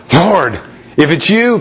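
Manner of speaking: a bold disciple calling across the water; eager Peter. Acted. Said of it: "Peter replied".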